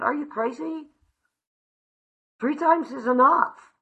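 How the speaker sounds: high, loud disbelief; incredulous retort. Retold or imitated. Arguing with her sponsor about trying again, incredulous. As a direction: throw it back at her like she's lost it.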